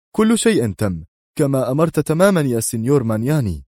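lightly voiced anxious inspector; nervous inspector. A narrator's reading. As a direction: play the flustered inspector eager to please.

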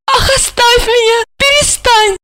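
очень высоко, весело протестуя; отбиваться смеясь; радостно отбиваясь. Acted. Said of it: "радостно отбивалась она". (Ольга отбивается, пока Жарон кружит её)

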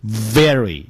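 "very" with a firm buzzing v; careful "very" with true v; correct v. Shown as a demonstration. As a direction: teeth on lip to begin "very". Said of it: "要念成".

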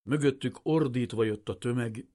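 ordinary delivery

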